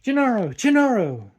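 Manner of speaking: loud, high-pitched shout of a name; screamed call. A narrator's reading. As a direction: call out at the top of your voice. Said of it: "he screamed out".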